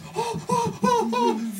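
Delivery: imitated high panicked gasps; repeated startled gasps, very high; freaked-out gasps. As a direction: act out gasping in shock. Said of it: "freaked out like only you can be".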